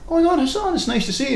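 high-pitched, delighted mock greeting; surprised greeting. Colleague meets Hassan at a meeting, high surprised greeting.

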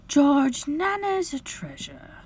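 high, breathy, fond protest; gentle wife. A narrator's reading. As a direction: soothe him while defending a loved one. Wife gently defending the nurse.

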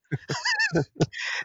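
high squealing laugh; cracking up at the memory; burst of laughter. Real, not acted. Laughs at friend's crying story.

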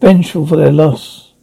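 ordinary delivery

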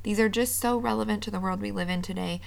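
ordinary delivery

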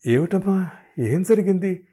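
alarmed mother-in-law's anxious question. Acted. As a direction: ask anxiously, leaning in. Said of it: "ఆరాటంగా".

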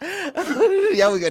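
high, laughing, slow Liberian English; laughing Liberian accent line. Shown as a demonstration. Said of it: "That's the accent".

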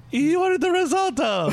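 high, loud Italian friend teasing. Retold or imitated. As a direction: squeal it like the punchline's coming. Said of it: "And he's like".

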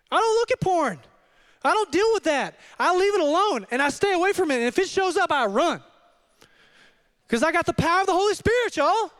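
high, emphatic testimony; fired-up pulpit delivery; impassioned preaching. Real, not acted. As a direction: declare it with preacher's fervour. Preaches with full force.

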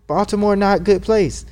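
a hint of the puzzled doctor's voice.

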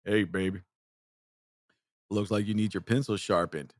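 low, mock-seductive come-on; pickup-line voice.

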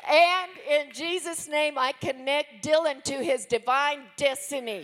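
forceful prayer declaration. Real, not acted. Woman praying against the devil, forceful declaration. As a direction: address the devil with firm authority.

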